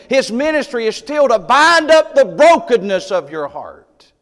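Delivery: preaching at full force to a broken church. Acted. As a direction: proclaim it over the congregation.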